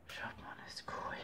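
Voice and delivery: quick whispered aside; whispering. Real, not acted. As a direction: whisper it to the viewers. Aside while showing picture.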